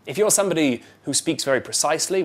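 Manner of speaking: usual speaking voice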